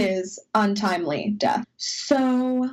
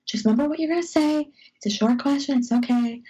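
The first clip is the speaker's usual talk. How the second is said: 1 nervous self pep talk; 5 semitones higher, faster than usual. Retold or imitated. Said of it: "and I'm like".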